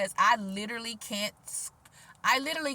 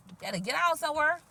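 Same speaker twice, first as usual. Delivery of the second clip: usual speaking voice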